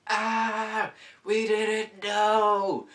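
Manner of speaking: very high, loud, drawn-out slow wail; mock group scream. Riders on the drop, wailing high and slow. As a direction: wail it like the floor just dropped. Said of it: "everybody's like".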